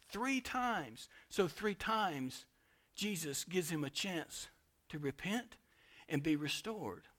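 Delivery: normal talking voice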